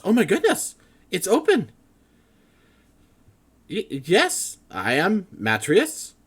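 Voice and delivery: high, loud, flustered scholar voice; excited scholar. Acted.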